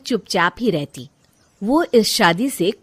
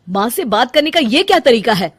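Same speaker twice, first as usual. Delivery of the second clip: louder, firm demand; stern reproach; 3 semitones higher, 6 dB louder than usual. Acted. Nirav scolding Rajni for how she spoke to his mother. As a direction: hold her to account.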